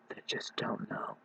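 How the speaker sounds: soft whisper. Real, not acted. Vlogger whispers about babies in cribs.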